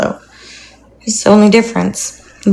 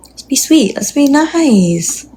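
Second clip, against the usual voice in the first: higher, very slow sing-song coaxing; coaxing the dog; 5 semitones higher, much slower than usual. Retold or imitated. Telling the pushy dog to be gentle, slow sweet coaxing. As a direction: draw out each word to soothe the pup. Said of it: "I'm like".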